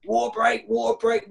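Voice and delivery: high call to stop play; calling a water break. Retold or imitated. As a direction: shout it across the pitch. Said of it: "Gaffer's called a water break".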